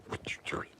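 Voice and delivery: hushed question to the dog; whispered question. Real, not acted.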